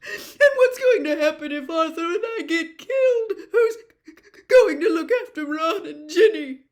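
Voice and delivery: high, loud, stammering through sobs; sobbing outpour. A narrator's reading. Missus Weasley lists her dread for the family, sobbing. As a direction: pour out every fear through tears.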